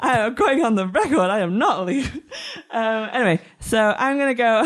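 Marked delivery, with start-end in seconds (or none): 0.0-2.1 laughing protest. Real, not acted. Laughing denial of a joke.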